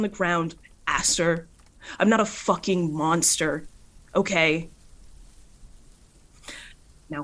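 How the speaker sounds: loud, heated tirade; angry outburst. Acted. Luna refuses to fight a downed Aster, loud angry rant. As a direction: spit it out, furious and proud. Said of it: "and she says".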